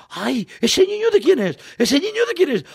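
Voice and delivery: voz de vecina imitada, mucho más aguda, más fuerte y lenta; vecina alarmada. Retold or imitated. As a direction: chilla como una vecina escandalizada. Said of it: "una vecina que entró a la carnicería dice". (una vecina grita al ver al niño bajo el caballo, voz aguda y alarmada)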